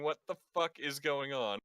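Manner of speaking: high, panicked character voice; alarmed NPC voice. Acted. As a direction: voice a startled shipwright. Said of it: "kinda looking around going".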